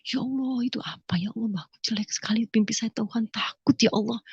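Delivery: hushed, whispered frightened prayer; berdoa ketakutan. Retold or imitated. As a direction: whisper a fearful plea to God. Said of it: "Saya ketakutan". (bisikan doa penuh ketakutan)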